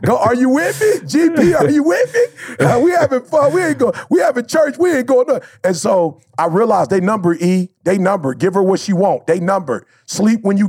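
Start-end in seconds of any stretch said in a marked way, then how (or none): 0.0-5.4 excited shouting. Retold or imitated. Telling his wife how he got going in bed, excited shouting. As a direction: shout it out like church is in full swing. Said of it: "And I was like".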